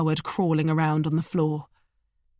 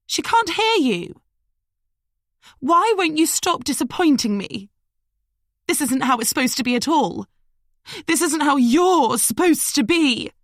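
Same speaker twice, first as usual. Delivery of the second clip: high, loud exasperated scolding; shrill scold; 9 semitones higher, 4 dB louder than usual. A narrator's reading. Madeline berating Liam up in the tree. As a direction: scold him like a let-down lover.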